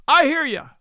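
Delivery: high, loud call back; shouted reply. Acted. Fisher lad answering Betteredge from a distance, shouting. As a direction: yell back across the sand. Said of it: "Tammy shouted back".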